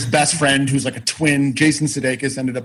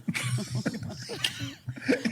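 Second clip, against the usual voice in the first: high, soft wheezing laugh; helpless laugh; 12 dB quieter than usual. Real, not acted. Writer laughing at own dated movie idea, helpless laugh. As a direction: crack up at your own story.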